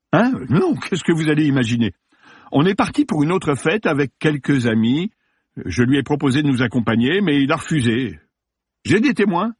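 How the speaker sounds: high, flustered self-defence; alarmed denial. Acted. Suspect actor giving police his alibi, alarmed denial. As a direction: protest innocence with the pitch up.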